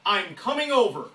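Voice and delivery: slow and loud, each word landed; confident slow announcement of the visit; firm magic words. Shown as a demonstration. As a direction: say it like a done deal. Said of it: "Three magic words".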